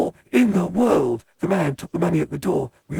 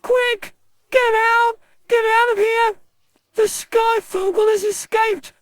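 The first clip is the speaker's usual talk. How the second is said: high, loud panicked scream; 4 dB louder, slower than usual. Acted. A torn, bloody showman screaming at the crowd, panicked scream. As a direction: yell the warning as if bloodied and fleeing. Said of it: "he screamed".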